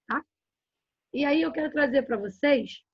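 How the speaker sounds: ordinary talk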